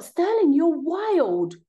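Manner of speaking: exasperated high call to a wild cat. Real, not acted. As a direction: shout at the cat before it jumps. Cat about to jump on laptop.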